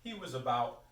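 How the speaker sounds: slow, projected recital; poetry reading. Acted. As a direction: recite it slowly, weighting each image. Reads own poem aloud.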